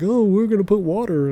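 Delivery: high, put-on voice of people who use water; mimicking someone who waters down the mix; mock other people. Mocking people who put water in it, in a high put-on voice. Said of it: "some people just be like".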